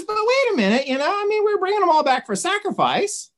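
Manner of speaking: high, pleading mock whine; defensive protest. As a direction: object in a squeaky, put-upon voice. Saul protests Samuel's rebuke.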